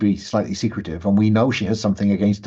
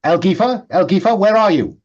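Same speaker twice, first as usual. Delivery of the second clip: higher, louder calling out; shouting; 10 semitones higher, 5 dB louder than usual. Acted. Someone calls for the missing woman, shouting. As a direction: call for someone who has gone missing. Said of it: "hear somebody shouting".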